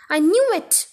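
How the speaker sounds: bellowed accusation. A narrator's reading. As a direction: burst out accusingly, short of a full shout. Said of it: "she bellowed".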